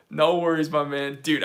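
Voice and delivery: usual speaking voice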